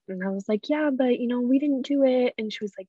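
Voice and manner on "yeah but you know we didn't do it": higher-pitched pleading objection; retold protest. Child to grandma denying blame, higher retold protest.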